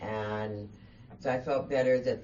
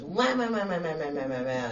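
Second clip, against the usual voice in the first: mock wah-wah babble; 2 semitones higher than usual. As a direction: turn her talk into teacher-style wah wah. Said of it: "and then she goes".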